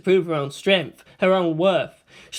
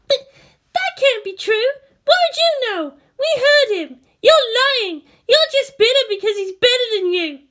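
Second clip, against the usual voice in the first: put-on much higher, tearful indignant girl; upset fangirl; 6 dB louder than usual. A narrator's reading. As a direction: defend your idol close to tears.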